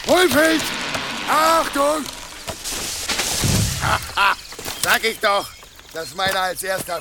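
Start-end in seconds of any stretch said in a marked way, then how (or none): 0.1-2.0 loud, high call of warning; warning shout. Acted. Woodcutter warns as tree falls. 2.1-4.8 laugh. Acted. Laughs after the tree falls.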